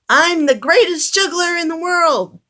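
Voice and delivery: high, loud bragging clown; boastful shout. A narrator's reading. Oliver boasts to the crowd with a loud high shout. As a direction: crow it out to the room. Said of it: "Oliver shouted".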